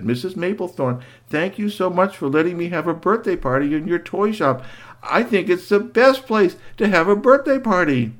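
a hint of a polite little girl. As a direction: thank her sweetly. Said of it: "very polite".

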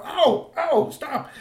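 high, yelping cries of pain; hurt cry. Acted. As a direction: yelp it like a hit little troll. Glabilda hit in the fight.

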